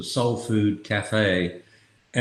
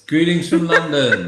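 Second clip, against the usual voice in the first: jolly greeting; 7 semitones higher, 6 dB louder than usual. Real, not acted. Dad greets listeners on podcast.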